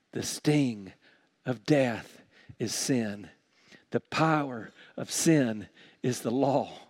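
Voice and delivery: weighty reading with pauses between phrases; deliberate scripture reading. Real, not acted. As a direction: let each phrase sink in. Rereads the verse for its meaning.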